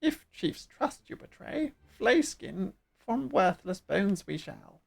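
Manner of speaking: threatening, swooping bird creature. Acted. Bird creature warning against betraying the chief, menacing. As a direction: warn them as a menacing bird. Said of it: "doing the bird voice".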